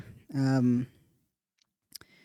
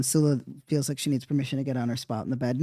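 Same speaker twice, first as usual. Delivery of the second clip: ordinary delivery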